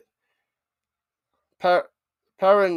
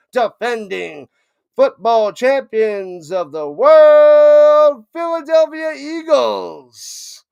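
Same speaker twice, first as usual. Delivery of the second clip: big promo read, swelling louder into the team name; hype announcer promo; 4 semitones higher, 4 dB louder than usual. Acted. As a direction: sell the channel like a stadium announcer. Hypes a friend's channel promo.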